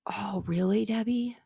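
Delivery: soft whispered reply; whispered surprise. Real, not acted. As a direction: hush it to Debbie.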